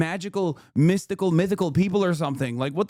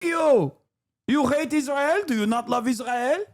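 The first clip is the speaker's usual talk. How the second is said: put-on much higher, slow, shouted Israeli accent; mock Israeli accent; 12 semitones higher, slower than usual. Mocking angry commenters in a shouted Israeli accent. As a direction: blow up into an indignant Israeli rant. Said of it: "pretty good Israeli accent".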